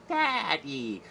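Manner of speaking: high, put-on baby cry; baby calling out. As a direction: call out like a freakish baby. Monster baby calls to Ted.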